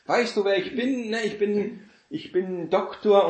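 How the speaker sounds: lightly voiced arguing show-off; mock boaster. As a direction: brag about your title like a show-off. Imagined quarrel, first boaster.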